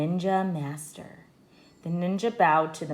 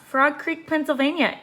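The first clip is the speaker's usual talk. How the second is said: Annie answering; 9 semitones higher, 3 dB louder than usual. A narrator's reading. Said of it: "Annie answered".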